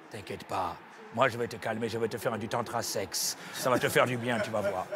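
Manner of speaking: very low, soft seductive purr; sultry low seducer. Acted. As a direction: play the smooth tantra seducer. Comic deep seductive voice.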